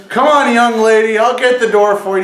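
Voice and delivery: put-on loud, high-pitched holler to an old lady; Joe Boy's call. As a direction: imitate a buddy calling out gallantly. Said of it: "He goes".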